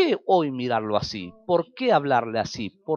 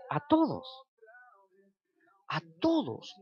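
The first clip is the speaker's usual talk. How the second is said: preacher's high cry; 10 semitones higher, 6 dB quieter than usual. Real, not acted. Preacher answering his own question.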